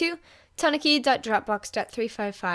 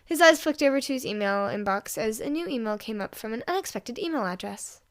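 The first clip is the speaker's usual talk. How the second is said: ordinary delivery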